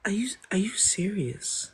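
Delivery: put-on high, whispery girl voice; breath-light falsetto, almost whispered. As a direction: put on a very soft girl's voice. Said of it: "Was my girl voice good?".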